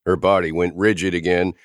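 plain reading voice

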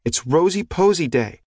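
put-on high, insistent little girl. A narrator's reading. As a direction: declare it like a proud little girl. Girl insists on her special day.

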